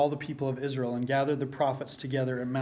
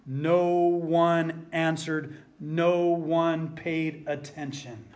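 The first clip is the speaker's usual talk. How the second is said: slow emphatic; much slower than usual. Acted. Repeats the line for effect.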